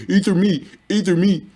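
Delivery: high taunting imitation of hecklers, singsong; mocking the channel idiots. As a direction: sneer it like a begging troll. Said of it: "idiots who don't have no views on the channels who keep poking at me".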